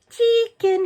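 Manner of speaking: high, loud sing-song call to a hen; coaxing call. A narrator's reading. George luring the hen with the spoon, coaxing. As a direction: coax the chicken over. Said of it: "he said".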